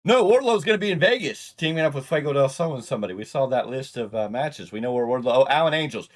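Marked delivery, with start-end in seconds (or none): 0.0-2.8 excited. Real, not acted. Excited correction.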